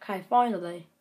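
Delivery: ordinary talk, nothing marked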